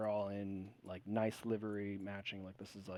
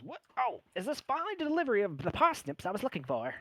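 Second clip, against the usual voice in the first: high, fast, fussy gnome chef voice; squeaky harried little chef; 10 semitones higher, 3 dB louder, faster than usual. Acted. A head chef to kitchen arrivals, high and fussy. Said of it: "and he goes".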